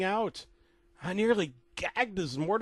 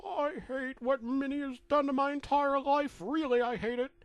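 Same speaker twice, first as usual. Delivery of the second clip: high cartoon Mickey, bitter; 10 semitones higher than usual. Mickey's last words in the chair, high and bitter. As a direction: spit out his last words. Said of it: "Mickey only replied with".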